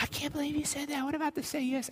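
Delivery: high mock gasp of disbelief; mock outraged listener. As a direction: squeak it like a shocked churchgoer. Imagined audience objection, falsetto.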